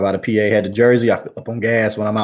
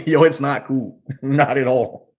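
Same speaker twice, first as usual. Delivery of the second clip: laughing; 3 semitones higher, slower than usual. Real, not acted. Laughs through the complaint.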